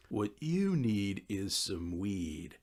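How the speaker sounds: a laid-back stoner pushing weed, slowly; stoner friend Jack. Acted.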